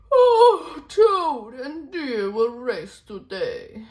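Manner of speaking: high, slow and loud, calling out the news; big storybook announcer call; excited announcement. Acted. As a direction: proclaim it to the whole jungle. Jaguar announces the race.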